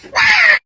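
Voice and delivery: high shouted cry; mock battle yell. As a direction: yell while diving. Diving at son.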